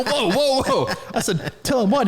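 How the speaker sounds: high-pitched, half-laughing mock alarm; alarmed backpedaling. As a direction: jump up in pitch to stop the child. Retold alarm at child's threat.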